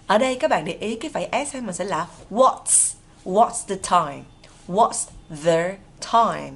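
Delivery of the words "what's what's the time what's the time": very slow, word by word; deliberate teacher dictation with pauses; slow model. Shown as a demonstration. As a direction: separate every word for learners. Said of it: "Đọc nhanh á".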